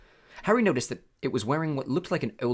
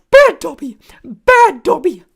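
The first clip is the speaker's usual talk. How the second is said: much higher and louder, slow shrieks; punishing himself, yelling; elf shouting; 17 dB louder, slower than usual. A narrator's reading. House elf banging his head, loud high shouts.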